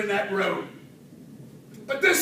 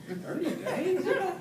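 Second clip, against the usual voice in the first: high, soft disbelief on "ago"; incredulous echo; 8 semitones higher, 7 dB quieter than usual. Retold or imitated. Patient repeating the doctor's news, incredulous.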